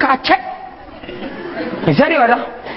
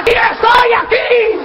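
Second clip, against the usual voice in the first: shouting across to someone; calling out; 9 semitones higher than usual. Acted. Llamando desde lejos. (llamando a gritos)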